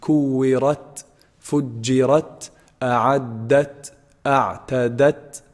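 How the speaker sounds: Kuwirat, fujirat, drawn out with a puff of air at the end; Arabic final ta with hams. Shown as a demonstration. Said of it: "you add S sound at the end".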